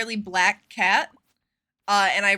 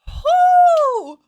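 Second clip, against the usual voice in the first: relieved whoop; 11 dB louder than usual. Real, not acted. Relief after close call.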